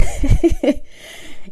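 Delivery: breathy giggle after cheering; giddy laugh. Real, not acted. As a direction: laugh at your own cheer.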